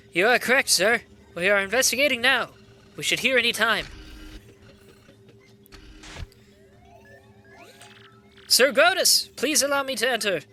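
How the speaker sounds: dutiful henchman. Acted. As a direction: report to the boss as an underling. Voices henchman addressing villain boss.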